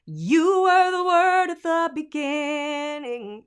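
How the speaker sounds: "You" begun with a firm "ya", loud, high and slow; big ya onset. Shown as a demonstration. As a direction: punch the Y at the start. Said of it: "getting a big ya".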